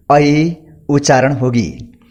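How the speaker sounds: ordinary delivery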